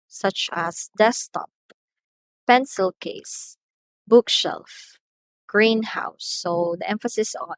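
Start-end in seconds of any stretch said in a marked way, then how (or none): 1.0-6.2 desktop, pencil case, bookshelf, greenhouse as a spaced list, each opening syllable punched; first-syllable stress. Shown as a demonstration. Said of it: "the stress is present on the first syllable".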